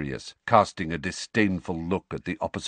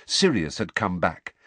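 ordinary delivery, nothing marked

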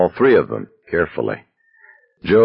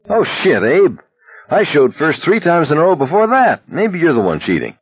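indignant; 10 semitones higher than usual. A narrator's reading. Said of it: "Abraham muttered".

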